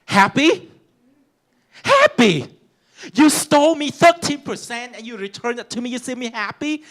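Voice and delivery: furious retort. Retold or imitated. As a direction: throw the word happy back at her. Acted confrontation with the teacher.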